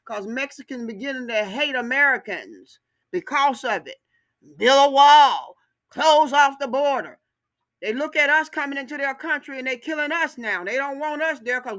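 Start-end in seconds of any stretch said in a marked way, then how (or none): 4.6-7.2 loud, slow, drawn-out shout of the slogan; mock slogan shout. Ranting vlogger shouts a mock political slogan, loud and slow. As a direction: bellow it like a rally chant, slowly. Mocking anti-immigrant chant.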